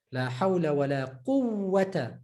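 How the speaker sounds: quwwata held long on the ww within the phrase; shadda on waw. Shown as a demonstration. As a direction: recite it, stretching the ww of quwwata. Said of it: "Shadda on the waw".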